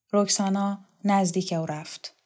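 usual speaking voice

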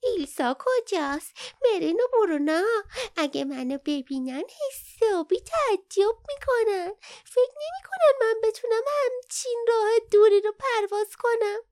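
very high, bouncy little creature voice; excited young creature. A narrator's reading. Young Kin looking for its friends, excitedly. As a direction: ask it jumping about, high and eager. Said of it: "بالا و پایین میپرید پرسید".

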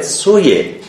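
سویه as "soye", short o; short vowel. Shown as a demonstration. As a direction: keep the first vowel of سویه an o, not oo. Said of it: "در محل اجرای کوتاست".

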